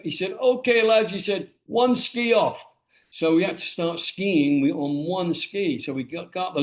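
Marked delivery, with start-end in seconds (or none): 0.3-1.1 higher-pitched imitation of a Scottish trainer; Scottish accent. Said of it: "in his Scottish accent". 1.7-2.7 high, slow Scottish instructor's order; Scottish accent. Retold or imitated. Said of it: "in his Scottish accent".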